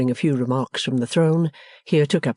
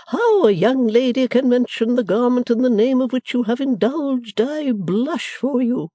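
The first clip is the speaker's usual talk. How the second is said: scornful matron; 10 semitones higher, 4 dB louder than usual. Acted. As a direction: look down on her daughter. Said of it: "with deep scorn".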